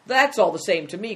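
defiant cry. Acted. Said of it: "cried the boy".

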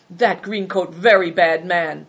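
slow, loud, emphatic character voice; broken-English confirmer. A narrator's reading. Servant warns the boys about green coat, confirming emphatically. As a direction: confirm it weightily, word by word. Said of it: "Bator confirmed".

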